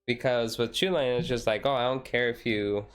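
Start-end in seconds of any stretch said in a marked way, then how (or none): none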